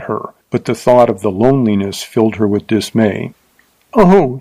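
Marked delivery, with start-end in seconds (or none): 3.9-4.4 a short, higher frightened oh; a young teacher suddenly afraid; frightened cry. A narrator's reading. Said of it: "she cried".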